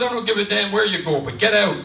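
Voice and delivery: acted exasperated outburst; angry wife. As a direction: throw him out, punching the last words. Wife throws husband out.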